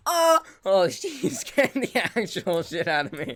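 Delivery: sudden scared yelp, then laughing; startled yelp. Real, not acted. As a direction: jump and shout in fright. Mob scared him.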